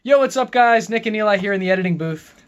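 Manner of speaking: loud, high, pumped-up hello; hyped greeting. Real, not acted. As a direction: hype up the audience. Video outro greeting.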